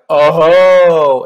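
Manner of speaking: a loud, high, drawn-out Oh of realization; dawning horror. Retold or imitated. Realizing where the laser will go, he lets out a loud horrified Oh. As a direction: let the horror dawn as it hits you.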